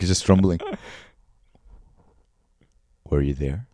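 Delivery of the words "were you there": low, husky Johnny Depp voice; Johnny Depp impression. Shown as a demonstration. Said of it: "let me try to do it".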